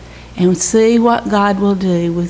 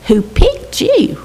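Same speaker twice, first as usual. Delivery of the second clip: tender emphasis; 10 semitones higher, slower than usual. Real, not acted. Comforting adopted girl.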